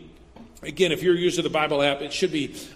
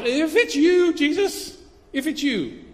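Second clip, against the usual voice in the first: doubting Peter; 9 semitones higher, slower than usual. Acted.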